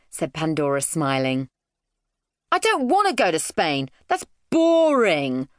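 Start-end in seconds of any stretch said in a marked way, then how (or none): 2.5-5.5 put-on high, sulky child's protest; sulk, dragging out "boring"; scowling. A narrator's reading.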